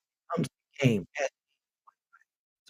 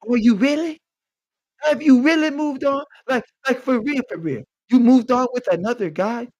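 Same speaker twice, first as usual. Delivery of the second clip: higher, louder incredulous whine; mock jealous guy; 6 semitones higher, 5 dB louder than usual. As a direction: ham up the needy disbelief. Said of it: "And he goes".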